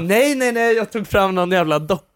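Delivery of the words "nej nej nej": higher, louder, rapid-fire refusals; frantic retold protest. Podcaster acts out a mother fighting over the iPad, frantic. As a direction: imitate a flustered mum fending off a child.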